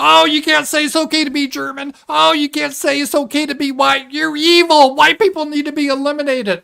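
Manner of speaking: high, loud whiny parody voice; hammed-up outraged scold; mocking shrill opponent. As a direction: lampoon the scolding critics. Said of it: "certain groups".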